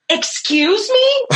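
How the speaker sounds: high, slow, laughing excuse me; mock indignant. Person in the front row deflecting blame, mock indignant. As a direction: play the innocent one, laughing. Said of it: "and the person goes".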